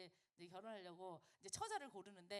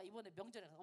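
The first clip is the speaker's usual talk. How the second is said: ordinary talk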